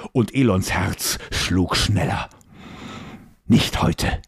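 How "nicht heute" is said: sharp unvoiced hiss. A narrator's reading. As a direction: hiss it through the teeth. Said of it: "zischte der Prinz".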